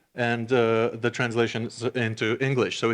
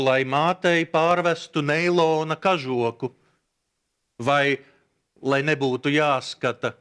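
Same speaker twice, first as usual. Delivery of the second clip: measured poem reading with deliberate pauses; poetry recitation. Acted. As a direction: recite the verse in spaced phrases, stretching key words. Reads a poem aloud in Latvian.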